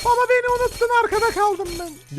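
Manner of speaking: put-on high whiny little-kid voice. Son's spirit calls to dad, high whiny child voice. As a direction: complain like a child left behind. Son's ghost left behind.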